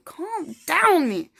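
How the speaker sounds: calm down shouted high and loud at herself; worked up. Real, not acted. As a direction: yell the order at yourself, still wound up.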